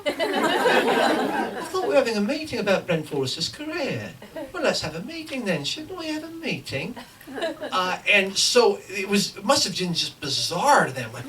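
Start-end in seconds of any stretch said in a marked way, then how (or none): 0.1-7.0 higher, insistent put-on English accent. Writer in disguise demands his career meeting from agents, English accent. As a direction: press the point in an English accent. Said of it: "I'm speaking in an English accent".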